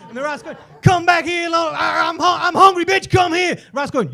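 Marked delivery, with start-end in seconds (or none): 0.8-3.6 high, loud, frantic acted shouting; yelling hungry cat. Hungry cat to the fleeing rat, frantic high yelling. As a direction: shout it desperately after escaping prey.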